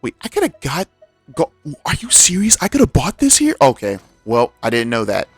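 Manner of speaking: ordinary delivery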